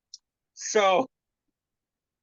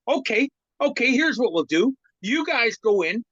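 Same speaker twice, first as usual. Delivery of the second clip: higher, eager voice for coach Baxi; Baxi bargaining; 8 semitones higher, 3 dB louder than usual. Retold or imitated. Coach Baxi hurriedly bargaining with the captains, higher and eager. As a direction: jump up high to cut a quick deal.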